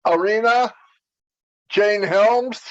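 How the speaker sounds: ordinary talk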